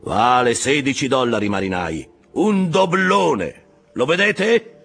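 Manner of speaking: loud, high rallying cry; captain crying out the prize to his men; shouting. A narrator's reading. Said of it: "Acabe gridò". (grido acuto e incalzante)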